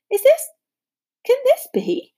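put-on high, astonished old barman; amazed. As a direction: gasp it out in wonder.

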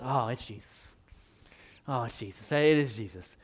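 very soft, low acted murmur; hushed realization. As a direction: let it sink in quietly, as the disciples. Acts out disciples' hushed recognition.

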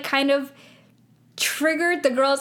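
ordinary talk, nothing marked

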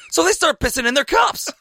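very high, incredulous American climax; loud American accent. Retold or imitated. American lad describing the Mexican wave at cricket, loud American accent. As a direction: build to a high-pitched American punchline. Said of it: "He's like your classic loud American".

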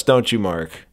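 ordinary talk

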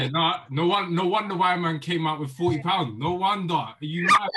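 loud, high excited teasing; excited shouting. Real, not acted. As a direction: shout it out in disbelief. Teasing friend, loud outburst.

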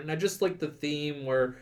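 ordinary talk, nothing marked

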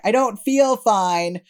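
mock-alarmed pushback, every word stretched; worried protest. Cast protesting to director, retold.

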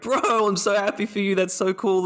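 excited, high-pitched imitation of a congratulating bro; Charlie Hunnam. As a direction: gush like a thrilled friend, pitched well up. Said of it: "Charlie Hunnam ... he was like".

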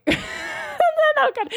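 high, breaking into laughter; real laughing. Real, not acted. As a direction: crack up mid-sentence, embarrassed. Cracks up at own awkward confession.

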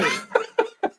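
squeaky laughing reply; laughing high okay. Retold or imitated. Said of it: "you're like".

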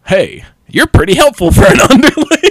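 light mock of a proud brute; a hint of cocky Inosuke. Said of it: "and he's like".

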